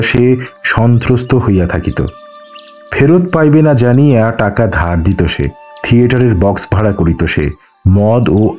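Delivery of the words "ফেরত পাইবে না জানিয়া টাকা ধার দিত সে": ordinary delivery, nothing marked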